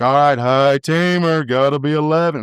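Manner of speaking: slow, drawn-out holler with stretched vowels; Speedy impression. Roper calling out before a run, slow drawn-out impersonation. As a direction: imitate a roping partner's long, sing-out call. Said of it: "That's a perfect impersonation".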